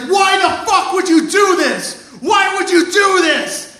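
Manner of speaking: high-pitched anguished shouting; acted furious high yell; screaming at him. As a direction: scream it as an outraged, betrayed boss. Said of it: "and starts screaming at him".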